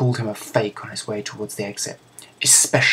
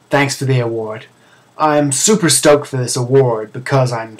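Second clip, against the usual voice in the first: loud, brash gamer voice, louder all through; cocky gamer cat; 8 dB louder than usual. Acted. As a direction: boast like a hyped-up streamer. Zyback's acceptance speech at ceremony.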